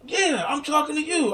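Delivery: high insistent voice; crackhead voice. Retold or imitated. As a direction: insist like the stranger. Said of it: "He's like".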